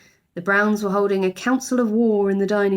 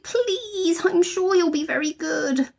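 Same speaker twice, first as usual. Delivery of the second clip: put-on pleading girl; 10 semitones higher, slower than usual. A narrator's reading. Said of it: "pleaded Judy".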